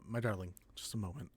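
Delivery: soft, tender aside to a lover. Acted. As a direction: murmur it gently to your darling. NPC speaks gently to his partner.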